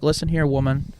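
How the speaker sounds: usual speaking voice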